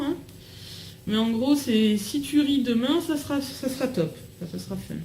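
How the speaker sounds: ordinary talk, nothing marked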